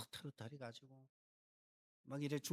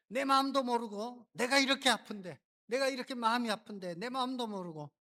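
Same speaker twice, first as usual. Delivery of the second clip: higher and louder, wounded complaint; aggrieved outburst; 7 semitones higher, 8 dB louder than usual. Retold or imitated. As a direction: cry out that nobody understands her pain. Hanna's imagined angry complaint. (높고 크게 서럽게 항변하듯)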